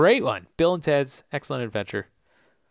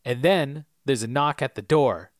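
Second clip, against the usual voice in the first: ordinary talk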